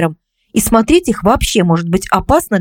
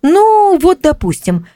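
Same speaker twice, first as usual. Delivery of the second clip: drawn-out high hesitation, then tentative; thinking, recalling; 9 semitones higher, slower than usual. A narrator's reading. Psychologist recalling an example for her friend, musing. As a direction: stall on a long raised first word while searching memory. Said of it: "ответила я и задумалась, припоминая".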